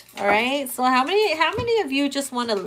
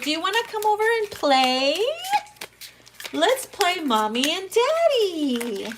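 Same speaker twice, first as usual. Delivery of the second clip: mock playful lover; 8 semitones higher than usual. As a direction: coax them sweetly in a put-on voice. Mimicking playful partner.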